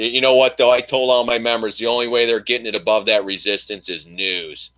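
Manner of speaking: ordinary talk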